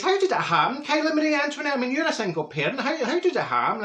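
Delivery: high, amazed questioning; nosy neighbour asking, high and amazed; astonished quote. Retold or imitated. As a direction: gasp it out in disbelief. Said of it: "I says".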